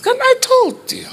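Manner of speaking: squealed, drawn-out told-you-so; imitated wife. Wife finds the noodles gone too, loud high mock protest. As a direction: shriek it slowly as the exasperated wife.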